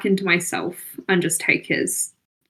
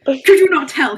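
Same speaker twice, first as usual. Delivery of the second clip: ordinary delivery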